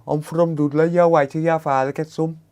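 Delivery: mock Dudley local telling you off; Dudley accent. Streamer mimicking a Dudley parent, in a Dudley accent. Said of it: "That's how they talk there".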